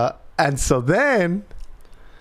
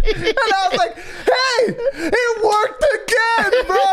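high, laughing through the words; laughing hard; slower than usual. Real, not acted. Dad telling friend his trick worked, laughing. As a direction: crack up while saying it. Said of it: "laughing while retelling".

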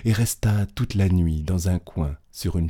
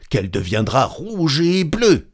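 higher, emphatic threat; menaçants; 6 semitones higher, 4 dB louder than usual. A narrator's reading. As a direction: threaten a beating, voice raised. Angry mob threatening a beating. (menace appuyée, voix montée)

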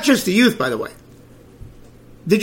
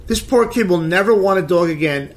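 ordinary talk, nothing marked

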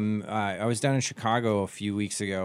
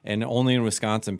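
ordinary talk, nothing marked

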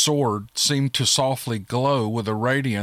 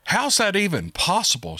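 shouted; 7 semitones higher than usual. A narrator's reading. Margolin stunned that creatures are being summoned, mildly shouted. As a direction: raise the pitch in disbelief, a mild shout. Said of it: "shouted Margolin".

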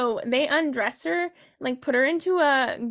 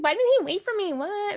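upset whine; 8 semitones higher than usual. Retold or imitated. Tally upset David is gone.

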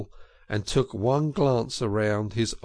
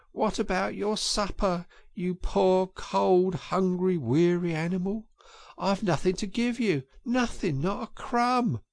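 moaning lament; 8 semitones higher than usual. A narrator's reading. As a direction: despair over the empty larder. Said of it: "he moaned".

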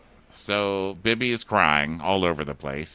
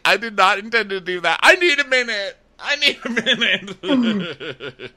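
very high, hammed-up wail; mock crying; 13 semitones higher, 5 dB louder than usual. Co-host as Jane after the taco disaster, mock crying. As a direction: imitate a tearful meltdown, pitched way up. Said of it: "And she starts crying".

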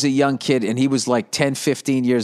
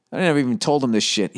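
ordinary delivery

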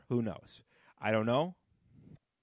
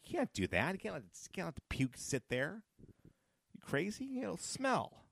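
higher, softer imitation of a scolding wife; imitating wife; 8 semitones higher, 4 dB quieter than usual. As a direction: lightly voice a wife telling him off. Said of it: "my wife, she's smart. She's like".

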